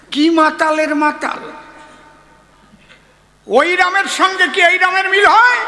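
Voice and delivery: loud, high mocking outburst; mocking exclamation. Real, not acted. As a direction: throw it out high and loud in disbelief. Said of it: "mocks the drunk". (উঁচু গলায় বিদ্রূপ করে চেঁচিয়ে)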